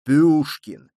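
loud, booming bass word; slipping into bass. A narrator's reading. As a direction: drop fully into the bass. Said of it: "окончательно сбился на басы курсант".

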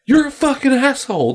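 high-pitched imitation of an upset child; mock a tearful kid's accusation up high; crying little boy. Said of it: "the little boy's crying".

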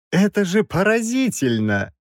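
high-pitched professor's wonder; amazed exclamation. A narrator's reading. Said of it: "воскликнул Краевский".

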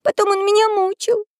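put-on high, small child's voice; little girl. A narrator's reading. Girl telling of her torment, high little-girl voice. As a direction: speak up high as a wronged child. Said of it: "повторила Мариам".

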